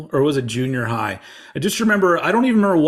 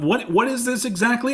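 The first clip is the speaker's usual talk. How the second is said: higher-pitched mock puzzlement; baffled outsider; 9 semitones higher than usual. Music fan mimics people puzzled by music, baffled. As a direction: imitate someone who has no clue. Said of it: "other people look at it and go".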